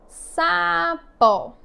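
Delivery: "Sapó" as a long sa, then pó; syllable by syllable. Shown as a demonstration. Teacher reading back the split word, "Sapó" syllable by syllable. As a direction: stretch and separate both syllables of "Sapó". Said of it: "fazer a separação silábica de sapo".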